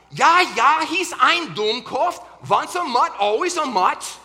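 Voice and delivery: put-on loud, high German accent, barked; barking German accent. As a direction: bark it like a gruff German Shepherd.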